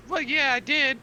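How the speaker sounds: high, quick eager recruit voice; eager pirate recruit. Acted. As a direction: play a keen little would-be pirate, pitched high. Same recruit figure.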